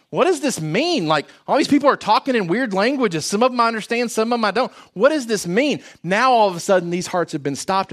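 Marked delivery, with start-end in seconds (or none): 0.1-5.8 high-pitched, baffled onlookers; mock bewilderment in a raised pitch; puzzled crowd. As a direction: voice a confused crowd, pitched well up. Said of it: "these hearts are saying".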